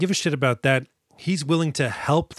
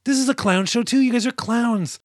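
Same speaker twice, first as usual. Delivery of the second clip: mock dismay; 10 semitones higher than usual. Host retelling his reaction to the settlers, higher mock dismay. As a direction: scoff at the clowns. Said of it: "And I was like".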